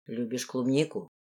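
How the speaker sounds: low, soft question; composed lord. A narrator's reading. The lord watches the girl eat berries, asking low and quiet.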